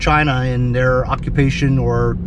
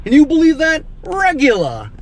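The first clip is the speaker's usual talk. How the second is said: high-pitched, loud disbelief; incredulous; 13 semitones higher, 3 dB louder than usual. Real, not acted. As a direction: exclaim it in outraged amazement. Incredulous at the gas price.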